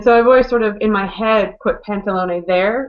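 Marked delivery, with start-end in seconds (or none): none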